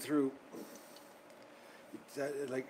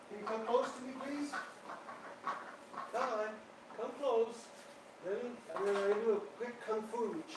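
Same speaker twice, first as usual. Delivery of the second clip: higher, soft cooing to a hurt pet; pet-talk voice; 6 semitones higher, 5 dB quieter than usual. Real, not acted. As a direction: coax the little duck gently, higher than usual. Coaxing injured pet duck.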